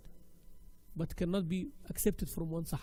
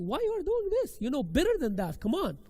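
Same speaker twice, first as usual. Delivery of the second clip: high-pitched exasperated scolding; pushing criticism; 7 semitones higher, 4 dB louder than usual. Pretend. Teacher pushes an advanced student, critical and demanding. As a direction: push them hard, voice jumping up. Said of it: "I'm gonna criticize you".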